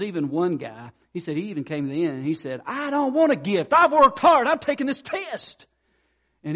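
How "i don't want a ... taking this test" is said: much higher and louder, indignant; a proud student insisting he'll earn it; high, loud, stubborn protest; defiant student. Retold or imitated. Proud student refuses the gift.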